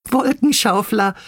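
put-on very high little-girl mole voice; mock it in a high, tittering squeak; teasing little sisters; giggly teasing. A narrator's reading. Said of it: "kicherten Bibi, Bini und Bonni".

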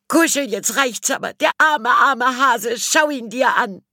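put-on very high scolding girl's voice; shrill-pitched girl rebuking Kuschel. A narrator's reading. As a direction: tell the cat off in the high Elli voice. Elli scolds the cat.

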